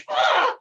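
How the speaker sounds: sudden loud cry of pain; pained yelp. Acted. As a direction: yelp as the ball hits. Hit by a cannonball.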